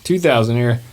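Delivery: hammed-up DJ plug, swooping pitch; playful radio host read; radio DJ patter. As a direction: sell it like a hype DJ.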